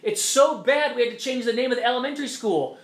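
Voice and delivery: sarcastic "isn't racism terrible"; mock hand-wringing. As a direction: parody pearl-clutching outrage. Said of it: "and you go".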